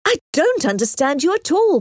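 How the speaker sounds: high-pitched, exasperated protest; indignant bafflement, high voice; bewildered cry. A narrator's reading. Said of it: "cried Emma".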